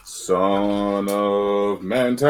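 slow, low, drawn-out announcer voice; dramatic announcer. Pretend. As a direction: boom the name out slowly.

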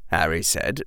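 usual speaking voice

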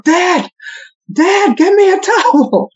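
high, drawn-out holler for Dad; kids yelling. Retold or imitated. Kids calling Dad from the upstairs shower, yelling. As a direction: yell it high like a child calling downstairs. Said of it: "My kids will yell out of the shower".

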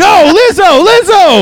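loud, high yell of the name. Acted. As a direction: shout her name in alarm. Teammate yelling at [name].

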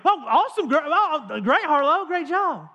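high, loud, overdone cheering; hammed-up proud dad; dad overpraising daughter's trick, high and loud; mock praise.

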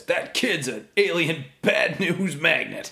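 mock movie quote. Quoting movie line.

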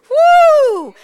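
high, loud exultant woo; joyful whoop. Real, not acted. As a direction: let out a shout of praise. Joyful preaching outburst.

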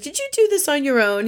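high-pitched imitated amazed question; acted wonder at a gift; mock amazement. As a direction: play impressed surprise, pitch lifted high. Acts out Jill marvelling at gift.

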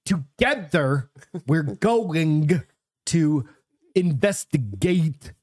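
slow, drawn-out mock TV host voice; Zach Baggins. Co-host mimics a ghost show host to tease, hammed-up impression. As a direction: stretch each word with dramatic pauses, hammed-up. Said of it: "he's gonna speak like this".

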